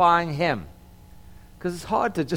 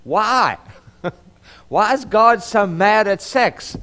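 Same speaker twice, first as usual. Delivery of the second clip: higher, louder mock protest; exasperated questioning; 5 semitones higher, 6 dB louder, slower than usual. Pastor voicing his own puzzlement to the congregation, comic exasperation. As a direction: blurt it out as a baffled complaint, half joking. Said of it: "thought about this a, a little bit and said".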